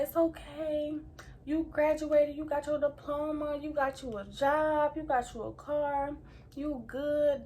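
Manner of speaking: drawn-out, gentle listing to calm someone down; comforting. Retold or imitated. Mama reassuring her crying pregnant daughter, comforting. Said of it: "She comforted me".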